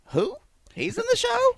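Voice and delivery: confused. Retold or imitated. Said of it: "who I go like".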